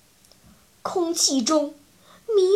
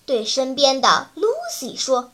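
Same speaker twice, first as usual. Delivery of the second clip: plain reading voice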